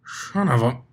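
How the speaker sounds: cursing through clenched teeth; mumbled curse through teeth and lunch; muffled curse. Acted. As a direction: spit it out around a mouthful. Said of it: "spat out a muffled curse through his clenched teeth".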